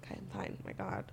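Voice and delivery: breathless whisper, recovering; whispered to herself. Real, not acted. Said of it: "I just need a moment to catch my breath".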